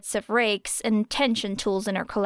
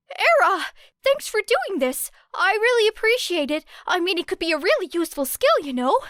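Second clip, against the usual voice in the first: much higher, bright-eyed rush of thanks; eager gushing; 9 semitones higher than usual. A narrator's reading. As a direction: gush like an overexcited fan, speeding up mid-line. Eager classmate gushes thanks.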